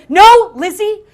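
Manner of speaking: high-pitched, horrified interruption; traumatised roommate. Retold or imitated. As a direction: cut in loudly, then recount the horror. Traumatised roommate cuts off apology.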